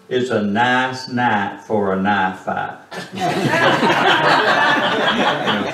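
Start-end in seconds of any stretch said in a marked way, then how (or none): 0.1-2.8 Southern saying. Retold or imitated. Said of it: "And he said". 2.8-5.1 high burst of laughter. Real, not acted. Laughs at own joke.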